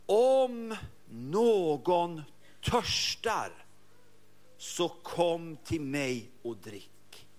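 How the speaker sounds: very slow, each word set apart; solemn invitation. Acted. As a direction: hold out the words like an open hand. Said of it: "Och så kommer det".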